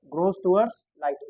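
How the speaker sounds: grows towards light, each word drawn out slowly; dictating to the class, very slow; slow dictation. Shown as a demonstration. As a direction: say it slowly so they can write it down. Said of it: "teacher repeats slowly for notes".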